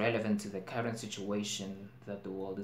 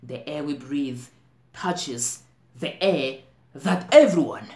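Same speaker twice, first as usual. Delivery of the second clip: slow, emphatic stage outburst, phrase by phrase; impassioned climax; 5 semitones higher, 13 dB louder than usual. Acted. Actor warning about the air everyone shares, impassioned climax. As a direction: swell to a loud, high cry.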